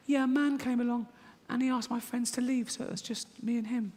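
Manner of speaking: put-on high childish girl voice; a small daughter piping up high; little girl voice. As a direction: play the six-year-old chirping to her dad. Comic voices six-year-old girl.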